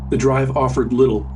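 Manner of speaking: plain reading voice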